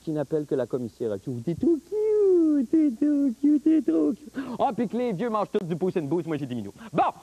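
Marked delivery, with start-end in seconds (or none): 1.4-4.3 loud mock gushing. Mocking cute gushing.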